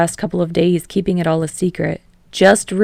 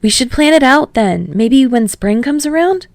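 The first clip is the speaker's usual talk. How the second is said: put-on higher, louder eager young man; Kirishima voice; 7 semitones higher, 5 dB louder than usual. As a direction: lift it up with excitement.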